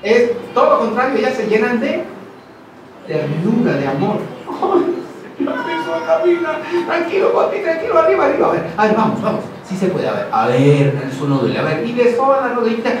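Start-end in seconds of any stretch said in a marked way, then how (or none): 4.5-11.8 much higher, cooing encouragement; tender mother. Retold or imitated. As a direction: coax a toddler to walk like a loving mom. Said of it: "las mamás que con ternura".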